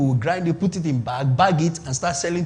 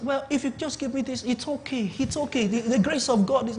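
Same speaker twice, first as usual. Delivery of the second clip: higher, softer pleading church-guy voice; light parody of a soft pious negotiator; mock timid churchgoer; 6 semitones higher, 5 dB quieter than usual. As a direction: mimic a meek believer giving in. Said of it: "no, don't talk like that".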